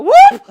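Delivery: sudden high, loud whoop; imitated whoop. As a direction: mimic a startled whoop as he topples. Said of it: "I just hear him go".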